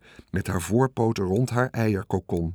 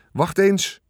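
higher urgent call; 8 semitones higher, 3 dB louder than usual. A narrator's reading. Michiel calling the others over to the plaque, eager. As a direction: stop them, you've spotted something. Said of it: "riep Michiel".

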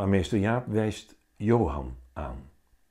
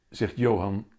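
ordinary delivery

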